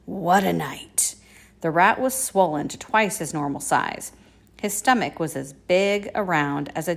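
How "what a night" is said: slow, husky exclamation. A narrator's reading. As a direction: drag it out huskily. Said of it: "he said in a husky voice".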